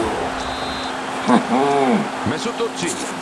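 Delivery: soft high-pitched giggle; a breathy delighted laugh. Real, not acted. Gamer laughing after scoring.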